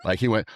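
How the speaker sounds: imitated wheeze of air knocked out; high breathy rush of air forced out. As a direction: push the air out like a gut-punched guy. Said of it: "I heard the air come out of his body".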